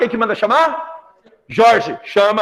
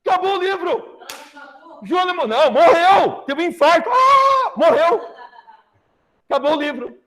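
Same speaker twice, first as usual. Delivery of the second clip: gleeful; 9 semitones higher than usual. Real, not acted. Teacher gleefully reports villain's death.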